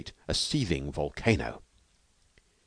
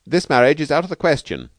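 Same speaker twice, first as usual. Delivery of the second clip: firm refusal, higher and louder; indignant Bailey; 9 semitones higher, 7 dB louder than usual. Acted. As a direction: forbid it outright, indignantly. Bailey forbids the marriage.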